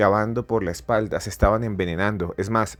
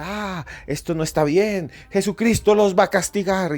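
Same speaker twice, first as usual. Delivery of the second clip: the pope yelling down from the pulpit; angry pope shouting threats at the crowd; furious shout; 10 semitones higher than usual. Retold or imitated. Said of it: "y gritaba y vociferaba".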